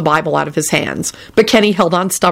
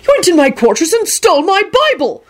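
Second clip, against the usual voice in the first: high-pitched outraged accusation; angry shout; 12 semitones higher than usual. A narrator's reading. Parks confronting the Bible thief, angry. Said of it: "Park shouted angrily".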